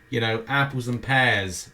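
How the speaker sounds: usual speaking voice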